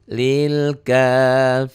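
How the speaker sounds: slow tajwid chant; Quran recitation. Shown as a demonstration. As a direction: draw the words out like a reciter. Recitation example. (lantunan tartil pelan)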